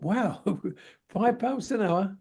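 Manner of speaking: slow, awed repeat of the figure; retold wonder, savouring the words; amazed. Young welder hearing the model's hourly pay, mock amazement.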